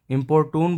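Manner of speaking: American way: इम्पोर्टून, toon at the end; American pronunciation. Shown as a demonstration. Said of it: "American English में इसको". (American उच्चारण: इम्पोर्टून)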